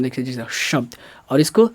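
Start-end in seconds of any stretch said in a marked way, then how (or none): 0.6-0.9 "शब्द" opening on a long hushing sh; heavier hiss. Shown as a demonstration. Teacher demonstrating sibilants to students, "शब्द" with a heavy hush. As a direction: lean on the sibilant starting "शब्द". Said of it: "इसको ज़्यादा गंभीर सनसनाहट में".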